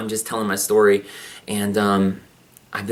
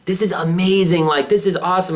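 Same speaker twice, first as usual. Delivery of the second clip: retold delight; 7 semitones higher than usual. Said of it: "being like".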